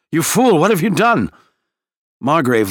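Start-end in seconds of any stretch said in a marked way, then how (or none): 0.1-1.3 angry shout. A narrator's reading. Gant rages at Margrave.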